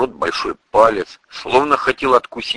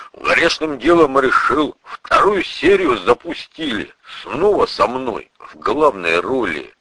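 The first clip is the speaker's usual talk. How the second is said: animated folksy storyteller, pitched up; hearty burly priest; 4 semitones higher than usual. Acted. Priest asks the narrator for a fancy word, lively and chummy. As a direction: spin the tale with swooping, chummy energy.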